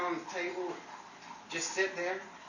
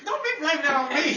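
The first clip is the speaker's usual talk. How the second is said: fast, loud, laughing denial; laughing protest; 9 semitones higher, 8 dB louder, faster than usual. Real, not acted. Castmate laughing as she denies smacking gum. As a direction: push back while cracking up.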